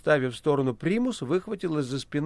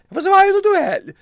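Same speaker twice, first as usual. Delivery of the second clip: high, loud challenging yell; 10 dB louder than usual. Acted. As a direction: bellow a duel challenge. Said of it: "проорал кот".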